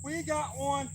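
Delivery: high, thrilled, a bit breathless; excited. Real, not acted. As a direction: say it like you can't believe it. Celebrating hooked fish.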